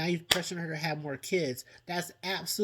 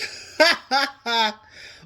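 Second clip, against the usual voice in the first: real laugh; 7 semitones higher, 7 dB louder than usual. Real, not acted. Laughing at the Butch moment.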